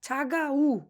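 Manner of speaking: teacher sounding out ছাগা উ; demonstrated pronunciation. Shown as a demonstration. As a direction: hold the final vowel in ছাগা উ. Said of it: "এটাকে আমরা উ বলবো".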